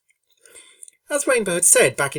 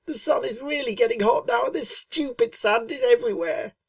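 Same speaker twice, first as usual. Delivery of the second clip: plaintive Rainbow Dash; 9 semitones higher than usual. A narrator's reading. Rainbow Dash pleading with Fluttershy for a swim, high plaintive voice. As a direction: beg like a hot, bored filly. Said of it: "asked Rainbow plaintively".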